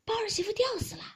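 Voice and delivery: high-pitched cry of bad news; startled servant's urgent report; alarmed report. A narrator's reading. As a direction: announce a death in shock. Servant reports a sudden hanging.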